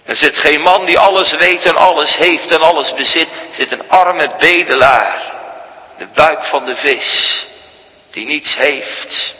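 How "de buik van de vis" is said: ordinary talk, nothing marked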